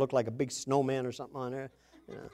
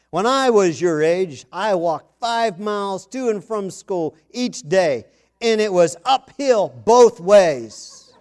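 lecturing dad; 3 semitones higher, 7 dB louder, slower than usual. Retold or imitated. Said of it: "to say the darndest things".

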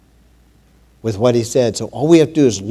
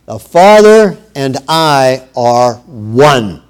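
firm, louder sermon voice proclaiming scripture; preaching Jesus's words; 6 dB louder than usual. Acted. Preacher voicing Jesus to the congregation, firm proclaiming. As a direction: speak as the shepherd claiming his sheep.

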